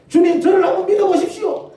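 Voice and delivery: high, loud, eager plea; greedy excitement. Acted. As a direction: pitch the jackpot dream to God. Greedy believer bargaining. (대박을 꿈꾸는 신자의 들뜬 외침)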